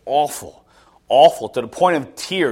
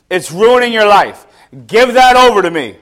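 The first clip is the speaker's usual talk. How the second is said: loud, raised-voice appeal; urgent pleading; 6 semitones higher, 7 dB louder than usual. Retold or imitated. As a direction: imitate God urgently calling someone to let go. God pleading with stubborn person.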